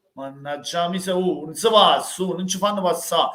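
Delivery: ordinary delivery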